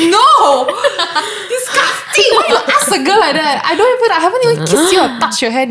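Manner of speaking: high, outraged shriek with laughter; mock outraged. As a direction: squeal in disgust, half laughing. Retelling her outraged reaction.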